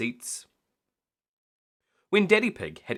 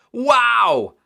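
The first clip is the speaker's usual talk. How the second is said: high loud amazed wow; thrilled wow; 12 semitones higher, 11 dB louder than usual. A narrator's reading. Children amazed by the helicopter, high loud wow. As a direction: gasp with delight. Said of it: "gasped Peppa and George".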